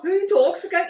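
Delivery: high, loud mock-incredulous question; loud, scoffing imitation; retold disbelief. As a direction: act out being appalled by the accent. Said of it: "I was all".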